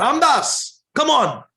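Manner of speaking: high, loud call. Acted. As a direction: call him from across the yard. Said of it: "he calls out to Ramdas".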